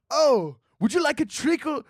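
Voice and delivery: high-pitched, put-on Seussian voice; mock-whimsical high lilt, rising question; whimsical Seussian server. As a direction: offer dessert in a high storybook voice. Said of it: "sound like, uh, Doctor Seuss fucking made them up".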